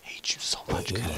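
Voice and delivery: hushed grudging whisper. Real, not acted. Gamer cursing a creeper named Cooper, hushed whisper. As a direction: hiss it at the creeper.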